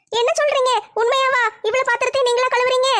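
younger sister voice. Acted. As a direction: pitch up as the weary little sister.

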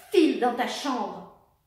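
slow, loud command; stern order. Acted. Mother orders daughter to her room, slow and loud. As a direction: send her away, word by word.

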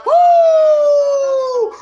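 high, loud celebration whoop; excited whoop. Real, not acted. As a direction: let out a big victory woo. Celebrating the knockdown.

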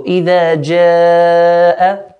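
loud, long-held aa in جَاءَ; madd elongation. Shown as a demonstration. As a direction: hold the aa of جَاءَ four or five counts. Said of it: "four or five counts".

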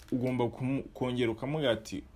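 ordinary delivery

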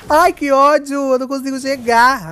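frustrated outburst. Real, not acted. Frustrated chasing enemy.

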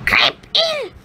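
very high, sly witch. Acted. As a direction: lure the girl into the oven. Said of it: "said the witch".

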